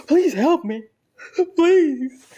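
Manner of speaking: loud, high mock begging; desperate plea. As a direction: plead helplessly, hammed-up. Begging waving coworkers for help.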